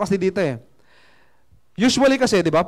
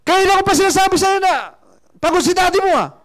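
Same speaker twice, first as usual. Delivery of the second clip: put-on high, loud scolding yell at his child; angry father yelling; 11 semitones higher, 4 dB louder than usual. Tired father yelling at his pestering child, high and loud. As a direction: snap at the kid, voice way up. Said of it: "Tumaas ang boses".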